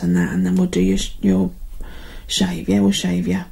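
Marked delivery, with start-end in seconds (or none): none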